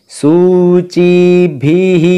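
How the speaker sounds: सूचिभिः very slow, the final visarga echoing hi; slow, stretched teaching demo; careful pronunciation. Shown as a demonstration. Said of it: "इस प्रकार से जो अक्षर यहाँ पर आएगा".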